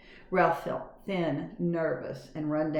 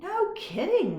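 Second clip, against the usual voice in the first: put-on amazed boy; 13 semitones higher, slower than usual. A narrator's reading. Garf voiced.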